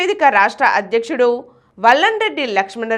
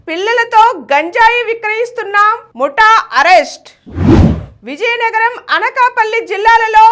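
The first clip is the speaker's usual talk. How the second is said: higher, punchy headline read over music; news headlines; 6 semitones higher, 4 dB louder than usual. Acted. Anchor reading the day's headlines to viewers, punchy. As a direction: announce each headline with punch.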